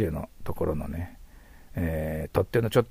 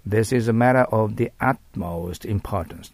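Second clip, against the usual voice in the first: model sentence; 3 dB louder, much slower than usual. Shown as a demonstration. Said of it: "となります".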